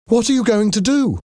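very high, urgent question; alarmed cry. A narrator's reading. Daughter seeing father seek his gun, alarmed. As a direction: cry the question out at the top of the pitch. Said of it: "Cosette cried".

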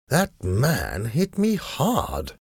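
slow, high-pitched drawl of complaint; complaining gentleman. A narrator's reading. Hurt man to himself in the dark, drawling complaint. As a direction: draw out the grievance with wide pitch swings. Sir Percy complains of a blow.

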